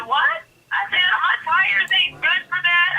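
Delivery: high-pitched retold disbelief; incredulous protest. Protests kidnapper's demand in retold argument.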